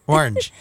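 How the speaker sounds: "Orange" squeezed into a single syllable; one-syllable orange. Real, not acted. Husband showing how they pronounce it, "Orange" in one syllable. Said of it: "you pronounce it with one syllable".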